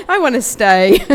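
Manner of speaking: loud laugh bursting through the words; laughing through tears. Real, not acted. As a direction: laugh it out to hold back the emotion. Tearful goodbye, laughing through emotion.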